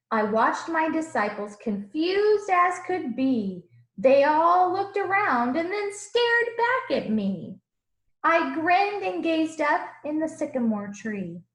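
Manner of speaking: put-on lively rhyming read-aloud for children; storybook reading. A narrator's reading. Teacher reading a picture book to the class, animated. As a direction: read the verses with bounce and warmth.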